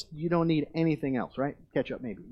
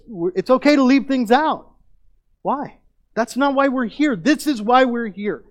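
raised, forceful preaching voice; loud sermon climax; 8 semitones higher, 9 dB louder than usual. Real, not acted. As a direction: drive the point home, loud and high.

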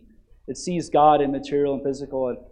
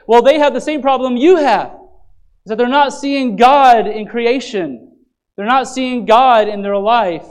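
loud, higher, pleading pulpit voice; impassioned preaching; 8 semitones higher, 10 dB louder than usual. Acted. Pastor urging the church not to get angry, impassioned preaching. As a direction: plead with them, full force. Passionate sermon on sin.

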